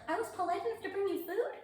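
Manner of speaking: high, fast, laughing question; laughing disbelief. Retold or imitated. Laughing disbelief at own quote.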